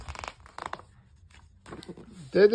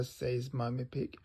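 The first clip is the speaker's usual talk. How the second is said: ordinary delivery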